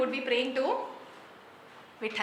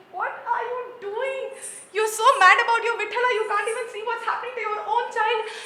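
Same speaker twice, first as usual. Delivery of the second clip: higher, louder scolding cry; angry scream; 8 semitones higher, 6 dB louder, faster than usual. Acted. Wife screaming at her husband over their hurt child. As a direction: yell at him in outrage for ignoring the child. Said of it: "she screamed".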